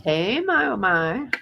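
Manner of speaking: high, bright hey; excited greeting. Real, not acted. Host welcoming a chat viewer, excited. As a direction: greet the newcomer with a lift.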